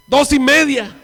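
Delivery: loud, high, insistent call; shouted count. Retold or imitated. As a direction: belt out the count like a challenge. Quoting the man's countdown. (conteo gritado, fuerte y agudo)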